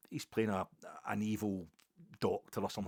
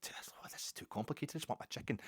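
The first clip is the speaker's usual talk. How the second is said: whispered frustration; 4 semitones higher, 6 dB quieter than usual. Retold or imitated. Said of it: "it was like".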